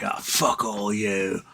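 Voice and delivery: cocky tavern thug voice; the A road cursing at the other roads in the pub, cocky bully voice; drunk bully A road. Retold or imitated. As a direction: sneer it like a bar bully mouthing off. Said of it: "He's like".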